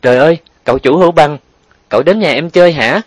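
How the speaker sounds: high, loud, excited surprise; quick burst of wide-eyed amazement; startling exclamation. A narrator's reading. Girl home from school greeting the young master, astonished. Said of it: "nó cất lời làm kinh động cả nhà".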